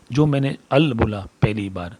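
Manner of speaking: ordinary talk, nothing marked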